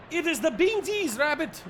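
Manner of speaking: a hint of the quirky sidekick; lightly voiced sidekick. Gabby character voiced.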